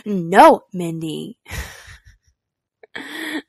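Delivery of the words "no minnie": put-on "No, Mindy" for Minnie, toddler's scold; a little kid's firm no; toddler scolding cat. Mom imitating her toddler telling off the cat. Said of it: "turns around and goes".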